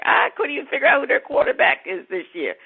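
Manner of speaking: ordinary talk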